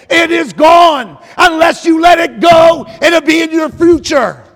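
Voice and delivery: declaring a word from God at full voice; full-force preaching. Real, not acted. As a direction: proclaim it slowly and forcefully to the congregation. Preacher delivers God's message forcefully.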